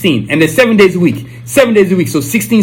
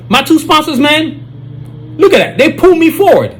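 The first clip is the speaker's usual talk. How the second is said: excited brag; 7 semitones higher than usual. Acted. Hyped bragging about sponsors.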